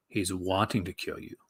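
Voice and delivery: lightly voiced trailer announcer; movie trailer voice. Shown as a demonstration. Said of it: "movie trailer guy voice".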